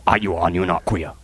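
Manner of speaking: normal talking voice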